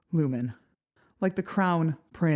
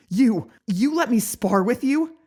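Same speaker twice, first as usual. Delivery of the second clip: shaky, near-hysterical shouts, much higher and louder; half hysterical; 9 semitones higher, 10 dB louder than usual. Acted. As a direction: let the voice crack with panic. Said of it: "My voice shakes a half hysterical lilt".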